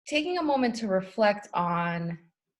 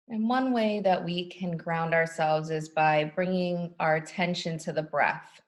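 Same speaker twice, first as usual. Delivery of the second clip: calm, unhurried meditation guide voice; guided meditation. Acted. Guiding the group into a grounding meditation, calm and unhurried. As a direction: ease the group into stillness, slow and even.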